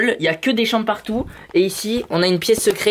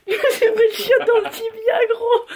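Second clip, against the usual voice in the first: high, pained laugh-cry; real hurt, laughing through it. Real, not acted. Hurt shin, laughing in pain.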